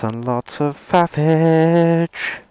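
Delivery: high, slow, stretched-out delivery; drawn-out playful. Acted. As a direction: savour the silly word. Playful drawn-out coined word.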